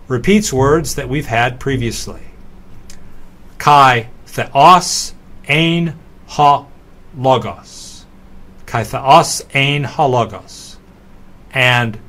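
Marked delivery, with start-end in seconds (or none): 3.6-8.1 word by word, with gaps between; slow, separated teaching read; very slow walkthrough. Shown as a demonstration. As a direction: read it out very slowly for learners. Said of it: "walk very slowly through this example".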